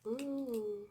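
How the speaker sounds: normal talking voice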